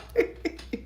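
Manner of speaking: high airless giggle; real giggle; breathy laugh. Real, not acted. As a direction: laugh silently. Laughing at card.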